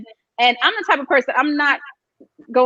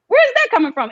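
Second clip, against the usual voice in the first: shocked disbelief; 11 semitones higher than usual. Retold or imitated. Caught off guard by the accusation at the reunion, high and stunned. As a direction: ask it like you can't believe your ears. Retelling her shocked reaction.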